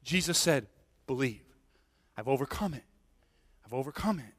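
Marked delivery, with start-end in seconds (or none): 1.1-4.3 low, soft and spaced out; quiet, calming assurance; hushed reassurance. Retold or imitated. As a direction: quote it gently, letting each phrase settle. Hushed reassurance quoting Jesus.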